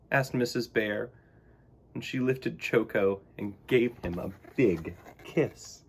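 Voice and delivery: ordinary delivery, nothing marked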